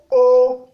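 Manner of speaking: O on a level tone below the high one; mid tone. Shown as a demonstration. Teacher showing the tones, O on mid tone. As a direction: hold O evenly, moderately high. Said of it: "ton moyen".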